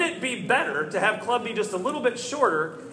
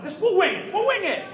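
pitched well up, tossed off eagerly; high-pitched, carefree self-persuasion; breezy overconfidence; 10 semitones higher, 3 dB louder than usual. Retold or imitated. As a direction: talk yourself into it in a high, hasty voice. Talking himself into improvising.

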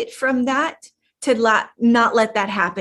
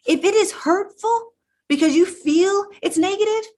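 higher, fervent rallying call; 7 semitones higher than usual. Real, not acted. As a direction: push them up with fire. Urging the listener to stand up.

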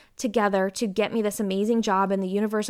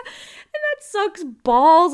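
much higher and louder, slow drawn-out complaint; exaggerated whine; 9 semitones higher, 10 dB louder, much slower than usual. Real, not acted. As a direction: stretch every word like a mock tantrum. Frustration about not moving.

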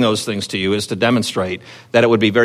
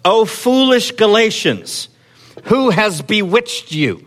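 slow, higher, a lightly voiced stern apostle; Paul's rebuke read aloud; 7 semitones higher, slower than usual. Preacher reads Paul's rebuke to the Galatians, slow and higher. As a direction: let each word land like a grieved rebuke. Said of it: "he says".

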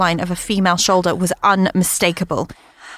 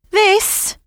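incredulous; 14 semitones higher than usual. Acted. Mum holds up dress.

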